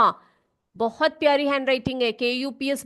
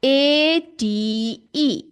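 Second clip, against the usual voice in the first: slow spelling aloud; much slower than usual. Shown as a demonstration. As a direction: drag out A T E letter by letter. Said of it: "यहां पर देखो इसके आगे".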